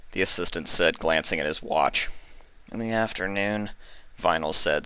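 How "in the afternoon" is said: half asleep. Acted. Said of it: "still half asleep".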